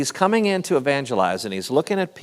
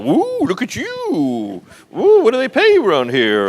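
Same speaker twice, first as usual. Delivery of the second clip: mock-impressed teasing; 9 semitones higher, 5 dB louder than usual. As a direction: tease him like an envious congregation. Voices listeners teasing his vacation.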